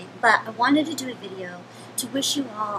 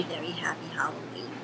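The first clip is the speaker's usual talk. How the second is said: whisper; 6 semitones lower, 9 dB quieter than usual. Acted. Whispered aside in character.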